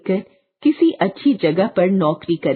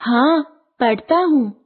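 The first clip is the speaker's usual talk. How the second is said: lightly voiced little boy, higher pitch; boy answering; 6 semitones higher than usual. A narrator's reading. Boy replying to his playmate, in a child's voice. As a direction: answer in a small boy's high voice. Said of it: "चांद बाबू ने कहा".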